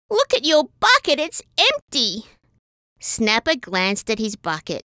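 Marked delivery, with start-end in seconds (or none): 0.1-2.3 high, urgent alarm; alarmed cry. A narrator's reading. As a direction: call out, pitched high. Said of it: "cried Bronte".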